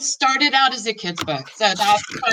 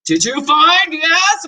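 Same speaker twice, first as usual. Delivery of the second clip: louder, slow carrying call; dad yelling; 7 semitones higher, 6 dB louder, slower than usual. A narrator's reading. Father yelling down to the basement, loud slow call. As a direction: call it down the stairs. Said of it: "my dad yells out".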